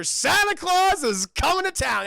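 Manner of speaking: high, loud mock proclamation; hammed-up, full-voiced announcement; triumphant shout. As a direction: belt it out as a big punchline. Acted triumphant punchline in story.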